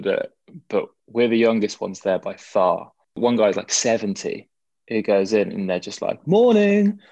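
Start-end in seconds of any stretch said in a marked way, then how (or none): 6.3-7.0 high, loud sing-out imitation of a greeting; mock-breezy, drawn-out morning call; cheery greeting. Older sea swimmers greeting newcomers as they go in, imitated cheery greeting.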